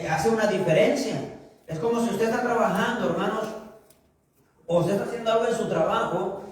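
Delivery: ordinary talk, nothing marked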